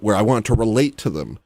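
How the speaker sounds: ordinary talk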